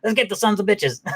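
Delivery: high, eager dog voice ready to fight. Retold or imitated. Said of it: "he was like".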